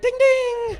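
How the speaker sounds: high ringing ding ding like a bike bell; mimicking a bike bell; bell imitation. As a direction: sing it out like a bell.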